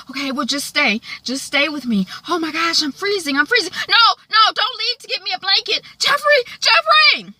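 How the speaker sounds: high plea building to loud calls; pleading panic. Retold or imitated. Wyatt in withdrawal begs Jeffrey to stay, pleading panic. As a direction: start needy, end crying out the name.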